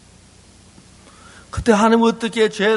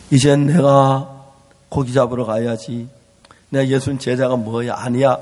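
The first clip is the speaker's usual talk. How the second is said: resigned self-talk; 8 semitones lower than usual. Acted. Peter's resigned inner speech.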